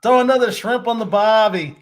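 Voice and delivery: mock Australian accent. Host joking he can't do accents, mock Australian accent. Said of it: "An Australian one, too".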